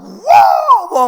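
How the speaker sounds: put-on very high, loud amazed children's wow; delighted kids' cry. Classmates marvel at the father's power, high excited wow. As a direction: shout wow like thrilled classmates. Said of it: "막 좋아가지고".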